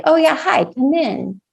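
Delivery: bright, high welcoming hello; cheery greeting. Retold or imitated. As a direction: greet them gladly at the door. Cheerful greeting of siblings in dream.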